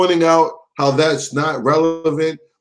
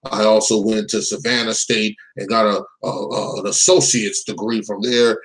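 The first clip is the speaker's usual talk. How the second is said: lightly voiced credential-spouter in a lower register; pretend attorney; 8 semitones lower than usual. Commentator pretending to be an attorney listing degrees, low mock-credential voice. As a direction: reel off fake degrees, pitched low. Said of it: "start spouting out some law degree".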